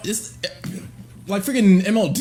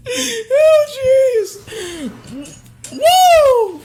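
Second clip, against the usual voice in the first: much higher and louder, whooping through laughter; laughing whoop; 14 semitones higher, 4 dB louder, much slower than usual. Real, not acted. Streamer losing it at the clip, laughing whoop. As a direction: let out a big whoop.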